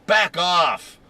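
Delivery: mock-furious bark to keep people away; retold angry shout. Angry shopper to the customers behind her, imitated yelling. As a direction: imitate her loud, high yell. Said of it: "the first thing she said".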